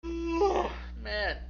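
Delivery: yawning right through the word; real yawn. Real, not acted. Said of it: "I don't know why I'm yawning".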